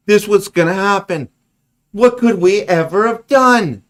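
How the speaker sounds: loud, high mock-astonished sarcasm; mock the newscasters with fake shock; sarcastic outrage. Real, not acted. Ranting at the news report, loud, high and sarcastic.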